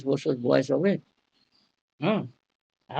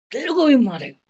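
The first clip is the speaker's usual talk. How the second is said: raised, higher mock threat as if holding a knife; 7 semitones higher, 5 dB louder, faster than usual. Teacher mimics patient threatening with a knife, raised mock threat. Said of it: "যে চাকু নিয়ে বলে না".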